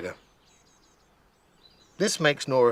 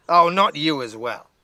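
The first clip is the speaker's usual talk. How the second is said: exasperated, louder outburst; shout; 5 semitones higher, 5 dB louder than usual. A narrator's reading. Nora shouts at the polar bear in exasperation. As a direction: throw up your hands and shout it. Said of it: "shouts Nora".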